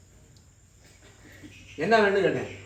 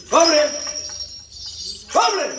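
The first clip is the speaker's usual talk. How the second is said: high-pitched, insistent order; commanding call; 5 semitones higher than usual. Real, not acted. Exorcist summoning the spirit, high commanding call.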